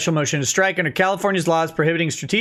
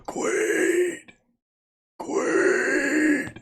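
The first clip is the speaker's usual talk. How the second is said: very high yelled cry, strained and stretched out; Lemoyne voice shout; 10 semitones higher than usual. Pretend. Streamer performing a chat request, very high shouted cry. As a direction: bellow it like a soldier calling from far off. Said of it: "in Lemoyne voice".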